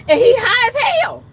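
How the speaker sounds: telling off the company about the stoned driver; mad outburst. Retold or imitated. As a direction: belt it out in outrage. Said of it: "I was so mad that I said".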